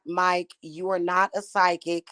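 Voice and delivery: normal talking voice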